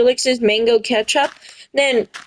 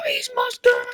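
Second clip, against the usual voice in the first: high, softer gasped realization; mock horror; 13 semitones higher, 5 dB quieter than usual. Kids realizing he is Mustard, high soft mock horror. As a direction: breathe it out high in shock. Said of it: "And then we're like".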